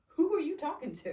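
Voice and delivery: imitating the husband's defensive snap; husband's indignant retort. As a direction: throw it back at her, offended. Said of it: "he'll come back to me and be like".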